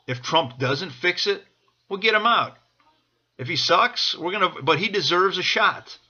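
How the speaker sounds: ordinary talk, nothing marked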